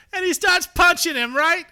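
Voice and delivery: loud high mock yell at a brawl; mock shouting. Comedian retelling a wrestling beatdown, shouting loud and high. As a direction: belt it out like a wild fight commentator.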